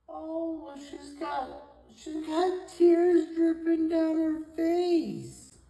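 very slow, high, loud wail of pity; drawn-out coo. Real, not acted. Same coo repeated, drawn out.

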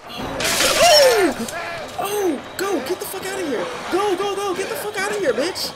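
high, panicked shouts urging yourself on; frantic yelling. Real, not acted. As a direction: yell and flee in a blind panic. Fleeing monster in panic.

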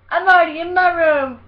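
loud, high protest; mock indignant retort. Kid answering dad's order, acted loud indignant protest. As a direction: shout back like an exasperated kid.

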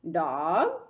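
loud rising bird call; squawked. A narrator's reading. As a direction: parrot squawk, swooping sharply upward. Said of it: "squawked Gertrude".

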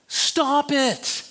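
high, exasperated command; mock scolding. Onlooker to a teenager mid-tantrum, exasperated mock scolding. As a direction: cut off the tantrum with an acted telling-off.

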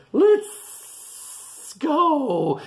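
high, loud snake with long hiss. A narrator's reading. Snake wants to leave, high hissing voice. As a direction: stretch every S into a hiss. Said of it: "says the snake".

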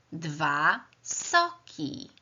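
ordinary delivery

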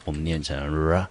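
ordinary talk

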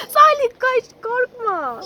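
excited laughing call. Real, not acted. Child runs from rabbit.

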